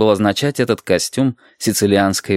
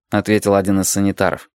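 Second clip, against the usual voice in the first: ordinary delivery, nothing marked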